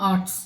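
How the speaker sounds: आर्ट्स with a long aa; teacher's model आर्ट्स, long aa; long aa vowel. Shown as a demonstration. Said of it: "ज़्यादा समय ले रहे हैं".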